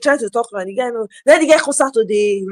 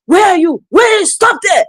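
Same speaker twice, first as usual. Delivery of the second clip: high, loud calls of a pursuer; mock chase shout; 9 semitones higher, 4 dB louder than usual. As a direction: shout after someone running off. Villagers chasing and shouting.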